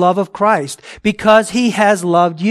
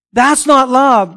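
higher and louder, slow and firm; emphatic denial; 7 semitones higher, 6 dB louder, slower than usual. Real, not acted. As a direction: slam the door on that idea, slowly. Preacher rejects the false view.

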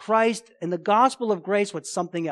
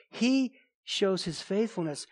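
ordinary delivery

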